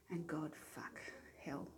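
whispered passion in the story; breathy whisper. Acted. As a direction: hush it like a secret thrill. Steamy passage read aloud.

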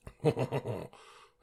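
loud, high mock-French "hon hon" laugh; French noise. Shown as a demonstration. Can't do French, so just a mock French laugh. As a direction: do a stock French chuckle.

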